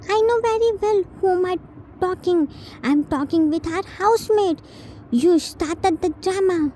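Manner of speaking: put-on high, quick defiant child; bratty retort. T talking back to Jin, high and bratty. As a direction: fire it back cheekily.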